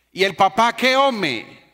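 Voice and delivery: put-on high, loud call of a deaf old man; old man shouting. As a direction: shout across the whole bus. Old man questioning loudly. (el viejito grita a todo el bus)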